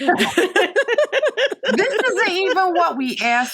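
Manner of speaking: high, slow, outraged complaint; mock the fed-up customers' disbelief; indignant customers. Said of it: "our customers were like".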